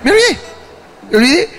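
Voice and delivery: high, rising, gleeful repeat; excited. Real, not acted. Telling friends the story, gleeful and high. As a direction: sell the punchline with glee. Laughing storyteller building to punchline.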